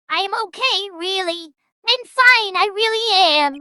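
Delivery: high, loud protest that she's fine; insistent shout. Acted. Reading a character's insistent line.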